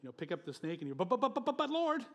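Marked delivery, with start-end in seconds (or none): 0.9-2.0 high, louder, stammering buts; stuttering Moses. Retold or imitated. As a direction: sputter out the protest in a panic. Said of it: "he kinda got a stutter".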